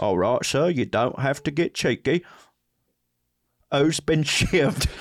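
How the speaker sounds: deadpan, measured Cockney; Cockney 911 operator. Acted. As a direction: chide the caller in Cockney. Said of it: "is Cockney".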